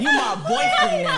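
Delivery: high, loud shout cracking with laughter; laughing shout. Retold or imitated. Retelling the prom demand to friends, high laughing shout. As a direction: yell it, giddy and demanding. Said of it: "She said".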